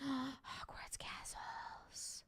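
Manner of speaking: gasped whisper of delight; excited whisper. Real, not acted. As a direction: breathe it out, almost no voice. Gasps at a Lego set.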